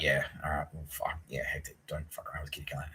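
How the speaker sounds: mumbling military police. Retold or imitated. Military police shrugging about Kitty Callahan, fast and hushed. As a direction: rattle it off under the breath.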